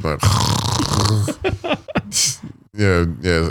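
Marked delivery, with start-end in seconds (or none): 0.2-2.5 exaggerated pretend snore; fake snoring. Man pretending to sleep to dodge his ex's question, fake snore. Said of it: "like, faking sleep".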